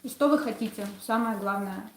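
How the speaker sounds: usual speaking voice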